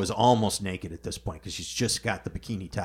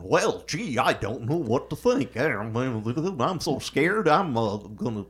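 higher, hammy scared robot; 4 semitones higher, 4 dB louder, slower than usual. Retold or imitated. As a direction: play a jittery cowardly robot. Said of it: "that impression [name] has pretty much hit dead on".

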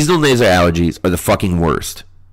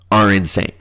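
usual speaking voice

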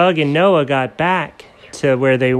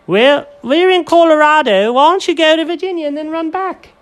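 squeaky friends; 5 dB louder than usual. Acted. Group high voice.

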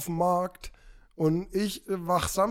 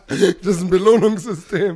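laughing; 9 dB louder than usual. Real, not acted. Laughing at own joke.

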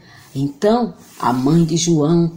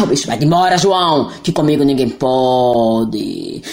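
friendly monster voice; 6 semitones lower than usual. Acted.

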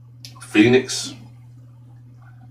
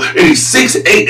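ordinary delivery

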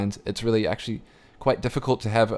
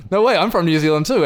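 higher, louder retold excitement; excited; 8 semitones higher, 5 dB louder than usual. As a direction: blurt it out, thrilled to find a fellow Kiwi. Excited to meet fellow Kiwi.

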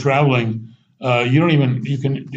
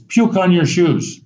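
proud mistake; 6 semitones higher, slower than usual. Retold or imitated. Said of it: "wheels turning in his little head".

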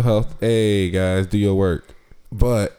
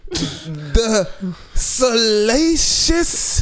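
stretched-out high showman title; grand self-announcement; 10 semitones higher, much slower than usual. Pretend. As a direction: savor each syllable grandly. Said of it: "Say it with me".

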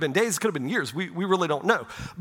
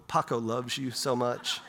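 put-on soft, tender murmur to a baby grandson; gentle; 7 semitones lower, 6 dB quieter than usual. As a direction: speak softly as if holding a newborn. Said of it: "I just so gently".

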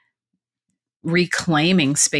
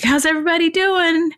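high, cheery instructor check-in; class instructor call-out; 11 semitones higher than usual. Retold or imitated.

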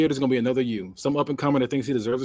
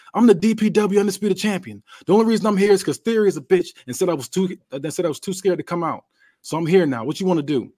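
loud, higher heated yell at the challenger; 6 semitones higher, 3 dB louder than usual. Acted. Champ yelling at his rival while security holds them back. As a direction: shout him down across the room.

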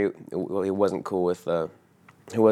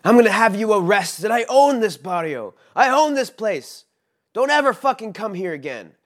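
much higher, louder, yelled threats; retold furious yelling; 13 semitones higher, 4 dB louder than usual. Angry host yells threats after the departing guest, furious. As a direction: shout down the stairs like an enraged host. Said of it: "And he yells after me".

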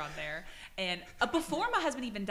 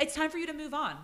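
louder stern repeat, higher; scolding, higher; 8 semitones higher, 4 dB louder than usual. Retold or imitated. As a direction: hammer it home. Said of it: "I would tell those pussies".

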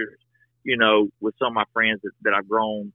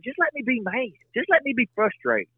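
higher pleading voice; 11 semitones higher than usual. Retold or imitated. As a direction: plead to be left mad. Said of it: "I tell my wife all the time".

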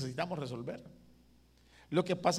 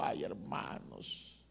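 breathy whisper, much softer and lower; whispered aside; 13 semitones lower, 9 dB quieter, much slower than usual. Real, not acted. Pastor confiding to his brothers in a whisper. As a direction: lean in and confide it.

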